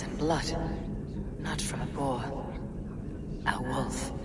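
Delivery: whisper. Acted. Tracking wolf trail, whispering.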